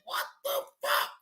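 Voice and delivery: high, small, startled squeak; squeaky scared reply. Retold or imitated. Streamer retelling his reply to Just Jay, high scared squeak. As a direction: squeak it out like he's frightened. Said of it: "I say".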